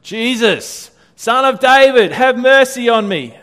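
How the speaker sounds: loud, high desperate cry. Acted. Blind beggar crying out to Jesus, loud and pleading. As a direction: call out over a crowd, pleading.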